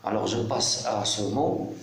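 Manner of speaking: usual speaking voice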